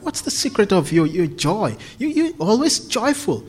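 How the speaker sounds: ordinary delivery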